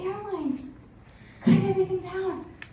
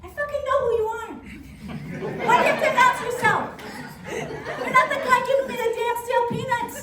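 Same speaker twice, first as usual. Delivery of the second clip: high, loud, fast comic outrage; exasperated retort; 7 semitones higher, 4 dB louder, faster than usual. Acted. Passenger talking back to the intercom, exasperated. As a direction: heckle back, high-pitched and fed up.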